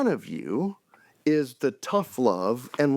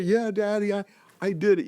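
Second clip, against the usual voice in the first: put-on higher, eager kid voice; eager little boy; 7 semitones higher than usual. Kindergarten son telling dad he did his homework, lightly voiced. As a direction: pipe up like a proud little boy.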